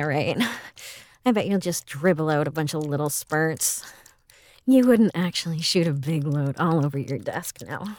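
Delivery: ordinary delivery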